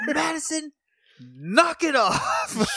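high, drawn-out exasperated shout; mock yell. Snapping at Madison in a recap, mock yell. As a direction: holler it to shut someone up, stretching the words. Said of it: "don't yell at her".

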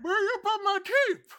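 put-on high toothless old man; old prospector. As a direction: demand the teeth back. NPC wants teeth.

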